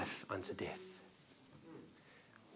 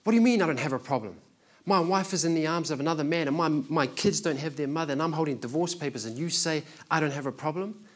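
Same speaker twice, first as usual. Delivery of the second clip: louder, higher and fast, retelling an indignant man; offended outburst; 5 semitones higher, 5 dB louder than usual. Retold or imitated. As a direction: fire back indignantly at God. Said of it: "He got so offended and said".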